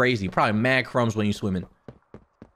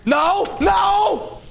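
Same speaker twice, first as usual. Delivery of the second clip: panicked no; 5 dB louder than usual. Real, not acted. Chased by monsters.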